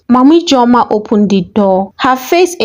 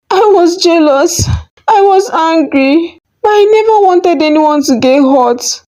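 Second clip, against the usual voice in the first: sobbing; 8 semitones higher than usual. A narrator's reading. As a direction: cry it out.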